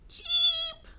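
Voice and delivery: very high, loud chirp; chick cheep. A narrator's reading. Turtle cheeping like a little chick. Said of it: "said Turtle".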